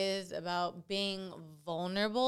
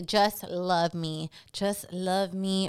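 slow pleading; 3 semitones lower, much slower than usual. Retold or imitated. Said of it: "so she's like".

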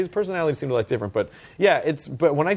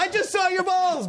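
slow, very high comic squeak; high mock voice. Reacting to the star's real voice, slow high squeak. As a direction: stretch it out in a squeaky falsetto.

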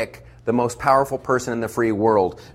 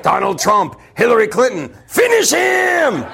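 game announcer; 7 semitones higher than usual. Retold or imitated. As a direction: boom it like a fighting game announcer. Mortal Kombat announcer.

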